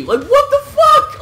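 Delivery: very high, slow, laughing what the fuck; shocked laughing outburst. Retold or imitated. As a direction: squeal it out through laughter.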